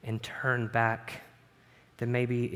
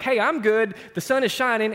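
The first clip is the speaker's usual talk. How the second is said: breezy self-satisfied; 8 semitones higher, 5 dB louder than usual. Retold or imitated. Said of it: "I feel like".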